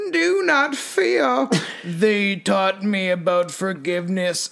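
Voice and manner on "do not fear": high, slow otherworldly voice; alien voice. Acted. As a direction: speak like a mantis being. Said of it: "it was being translated".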